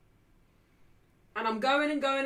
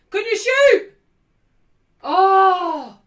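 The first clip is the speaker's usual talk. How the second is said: loud, high plea to shoot; urging shout; 6 semitones higher, 12 dB louder, much slower than usual. Real, not acted. Fan urging a player to shoot.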